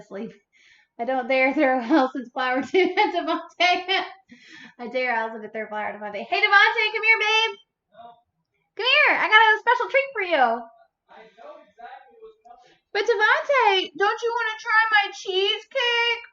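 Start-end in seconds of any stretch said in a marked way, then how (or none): 6.3-16.2 high, coaxing come-hither voice; seductive call. Pretend. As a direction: lure him in with a sugary tease. Calling husband with seductive offer.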